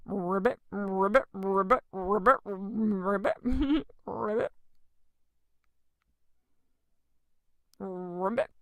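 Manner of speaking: croaking ribbits like a playful frog; children's sermon frog croaks. Pretend.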